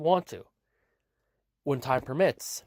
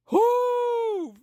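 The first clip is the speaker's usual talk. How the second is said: long, high held "whoo"; high whoop; 3 dB louder than usual. Acted. Reacting to wrestling news, high drawn-out whoop. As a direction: let out a sustained high hoot.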